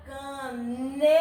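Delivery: caneta slowly, NE held long; stress on NE. Shown as a demonstration. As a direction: draw out the NE in caneta to reveal the stressed syllable. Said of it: "Qual é a sílaba tônica?". (caneta alongando bem o NE)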